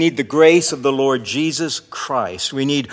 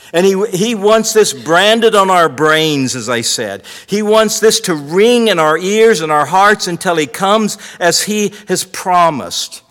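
higher, louder pulpit voice; forceful, raised sermon delivery; impassioned preaching; 6 semitones higher, 9 dB louder than usual. Real, not acted. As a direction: drive it home to the congregation.

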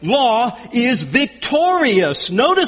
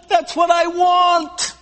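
emphatic cry; 7 semitones higher, slower than usual. Acted. Preacher voicing Paul's longing.

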